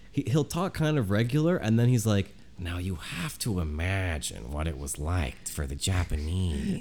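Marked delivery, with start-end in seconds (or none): 2.6-6.8 Dan Carlin narration voice. Retold or imitated. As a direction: imitate Dan Carlin's grave storytelling. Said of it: "that's his voice when he's like".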